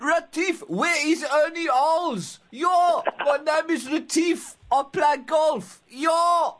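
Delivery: high, hammed-up South African golfer; South African accent. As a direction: play a boisterous South African golfer, slow and shouty. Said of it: "without doing it in a South African accent".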